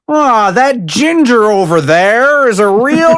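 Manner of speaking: put-on high, slow British accent send-up; mock British. Host imitating Brits calling someone a ginger, mock British accent. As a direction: imitate a Brit pointing someone out, drawn out. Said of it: "when they, like, call somebody a ginger".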